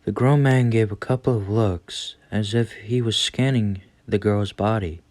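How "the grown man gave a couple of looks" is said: ordinary delivery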